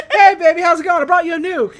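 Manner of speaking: loud, high hammed-up sweet talk; mock lizard wooing. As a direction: croon it big and silly to the girlfriend lizard. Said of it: "That's what this reminds me of".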